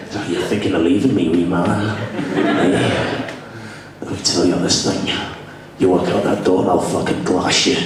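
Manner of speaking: low, menacing mock-Scottish voice; Scottish accent. Scottish fiancee threatening her man for leaving, low Scottish menace. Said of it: "my fiance is Scottish".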